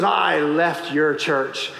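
low, slow, pointed accusation; cold woman. Retold or imitated. Former churchgoer telling her pastor she left, low and slow. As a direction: spell out each word, coldly. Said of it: "And she goes".

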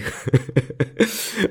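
high, amused giggle; amused laugh. Real, not acted. Podcaster amused by denying someone's feelings, giggling. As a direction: laugh at your own absurd example. Laughs at own absurd example.

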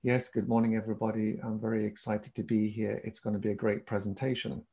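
low, soft, dull greeting; unexcited monotone. Shown as a demonstration. Bored presenter greeting the audience, in a lifeless monotone. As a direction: claim excitement with no energy at all. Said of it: "There's no point saying".

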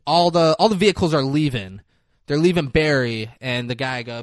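normal talking voice

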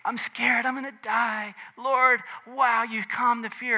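high-pitched, lightly acted panic; hammed-up frightened plea; mock fear. Acts out the frightened disciples.

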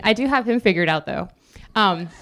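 ordinary delivery

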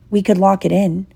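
usual speaking voice

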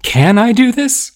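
high, nervous self-doubt; anxious question. A narrator's reading. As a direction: squeak out the worried question. Heroine's anxious thought on stage.

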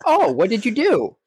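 high put-on old man voice; Master Roshi. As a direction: ask it in a squeaky cartoon old man voice. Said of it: "Master Roshi".

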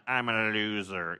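slow, mopey mock of himself; self-deprecating. Pastor voicing his own insecurity, slow and mopey. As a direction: droop it out like a self-declared loser. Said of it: "'Cause I'm like".

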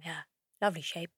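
low, soft, quick leer; sleazy Joe. Retold or imitated. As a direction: murmur it like a creep. Joe leering at her bum.

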